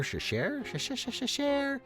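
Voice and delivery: plain reading voice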